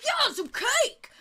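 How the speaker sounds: very high, slow loud cheer; second party guest. Acted. Somebody at the party demanding cake, high drawn-out yell. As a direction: chime in with a high-pitched call. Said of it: "Somebody goes".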